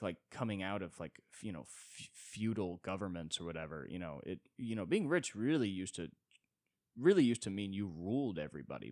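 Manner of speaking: ordinary delivery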